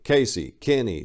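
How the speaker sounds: ordinary delivery, nothing marked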